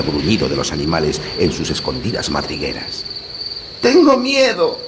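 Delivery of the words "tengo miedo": put-on high, slow fearful girl; scared little girl. A narrator's reading. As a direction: whimper it like a scared child. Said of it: "dijo Gretel".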